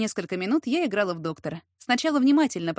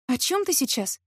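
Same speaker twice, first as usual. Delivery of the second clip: raised-pitch puzzled question; surprised; 5 semitones higher than usual. A narrator's reading. Heroine turns to question his remark, surprised. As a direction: ask in open surprise. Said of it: "С удивлением спросила я".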